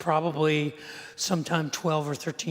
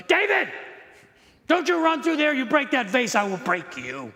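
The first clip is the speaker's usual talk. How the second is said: high, loud mom yell, dropping to a threat; scolding mother; 6 semitones higher, 4 dB louder than usual. Retold or imitated. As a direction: imitate a mother calling out a warning to her child. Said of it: "with my mother going".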